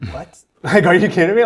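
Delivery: a laughing what, can't believe the offer; stunned disbelief. Retold or imitated. On hearing of the moose hunt, laughing surprised disbelief. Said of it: "I'm like".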